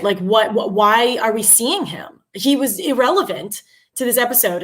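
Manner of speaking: ordinary talk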